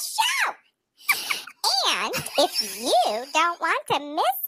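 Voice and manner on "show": chipmunk voice. Real, not acted. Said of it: "doesn't sound like a chipmunk".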